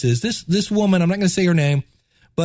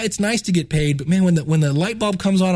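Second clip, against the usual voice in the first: ordinary talk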